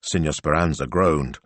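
ordinary delivery

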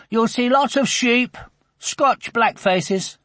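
knowing. A narrator's reading. Andrew telling Sophie about sheep, a mild know-it-all tone. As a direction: lay out the fact like an expert, stretching the last words. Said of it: "in a knowledgeable voice".

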